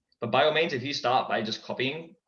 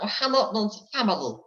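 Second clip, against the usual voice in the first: higher, slow, garbled alien creature voice; Stitch impression; 11 semitones higher than usual. Shown as a demonstration. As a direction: drag it out as Stitch. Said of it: "the Joker or Stitch".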